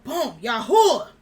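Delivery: yelled out. Retold or imitated. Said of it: "I yelled out".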